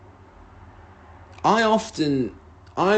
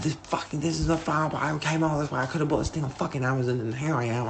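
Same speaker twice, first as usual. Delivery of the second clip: higher but softer exasperated rant; retold livid rant; 4 semitones higher, 5 dB quieter, faster than usual. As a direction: rant under your breath in disbelief. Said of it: "I'm livid. I can't believe it".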